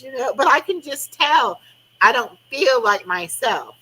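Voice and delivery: ordinary talk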